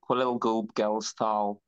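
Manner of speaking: "Quill Gub Gelstall" said very slowly, each syllable drawn out; slow name pronunciation. Shown as a demonstration. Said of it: "how am I meant to say it?".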